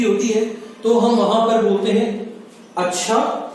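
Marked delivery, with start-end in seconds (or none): 2.8-3.4 अच्छा with a rising, questioning end; surprised. Shown as a demonstration. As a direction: ask अच्छा in mild wonder. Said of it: "विस्मय की स्थिति, आश्चर्य की स्थिति". (आश्चर्य से उठता हुआ अच्छा)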